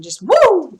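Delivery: high, loud woo; whooping like flailing arms; woo cry. Shown as a demonstration. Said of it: "like this".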